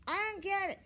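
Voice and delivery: put-on high, loud kid voice; a loud boy with no indoor voice. A narrator's reading. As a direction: boom it across the classroom. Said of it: "he said in his loud voice".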